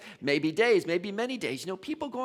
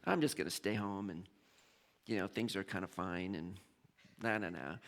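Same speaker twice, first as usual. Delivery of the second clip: low, soft, lazy brush-off; half-hearted dismissive mumble; dismissive shrug; 11 semitones lower, 10 dB quieter than usual. Retold or imitated. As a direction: mumble it off, not bothered. Other son shrugs it off.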